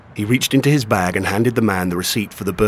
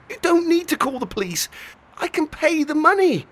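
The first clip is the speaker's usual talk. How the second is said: put-on boy voice in pain. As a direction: plead like a hurt teenage boy.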